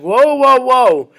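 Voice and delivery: mock alarm, pulling up short; British officials caught off guard, a startled whoa. British alarmed at tribes uniting.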